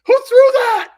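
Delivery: high, loud indignant yell; hammed-up furious coach; mock angry coach. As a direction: bark it at the room.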